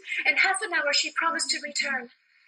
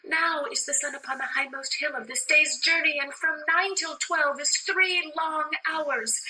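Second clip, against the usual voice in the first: ordinary talk, nothing marked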